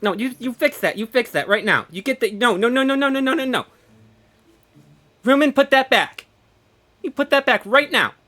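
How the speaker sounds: loud insistent orders; playful scolding. Real, not acted. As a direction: protest loudly, demanding it be undone. Scolds moderator for changing stream.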